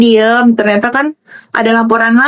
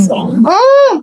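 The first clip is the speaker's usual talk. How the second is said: amused scoff; 9 semitones higher, much slower than usual. Real, not acted. Mocking after laughing.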